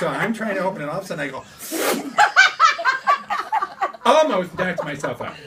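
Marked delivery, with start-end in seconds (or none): 1.5-4.0 high shrieking laugh at himself; high cackling laugh. Real, not acted. Recalling hitting his head on the cabinet, high laugh. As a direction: burst into a squealing laugh.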